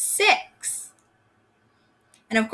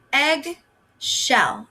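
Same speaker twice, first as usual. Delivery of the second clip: eggshell drawn out very slowly; teacher slowly modelling eggshell for young readers; slow stretched word; 3 semitones higher than usual. Shown as a demonstration. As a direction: stretch eggshell out so each part is heard. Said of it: "And our last one".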